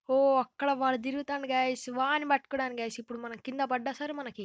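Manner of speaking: loud excited callout. Real, not acted. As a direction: shout it out, thrilled. Excited spotting seeker.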